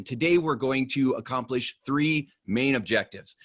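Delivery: usual speaking voice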